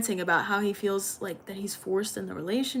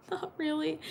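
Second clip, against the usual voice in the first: higher, softer, through a laugh; laughing, softer "Not really"; laughing repeat; 7 semitones higher, 6 dB quieter than usual. Real, not acted.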